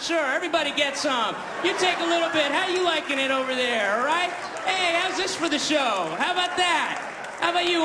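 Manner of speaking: high, cocky elephant voice showing off; gloating elephant. Acted. Elephant spraying the crowd, high gloating showman voice.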